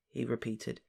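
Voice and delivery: plain reading voice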